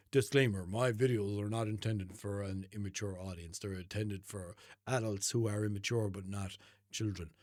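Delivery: low, soft disclaimer read. Pretend. Podcaster warning listeners about immature audiences, subdued disclaimer. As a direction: drop low and quiet for the small print.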